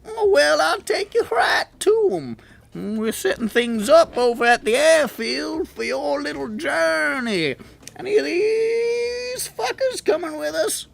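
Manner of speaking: high, squeaky goblin, eager and chummy; high excited goblin chatter. Acted. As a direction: pitch way up into a cheerful goblin squeak. GM voices Stitch the goblin.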